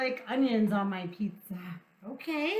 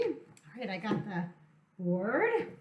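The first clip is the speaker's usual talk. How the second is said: usual speaking voice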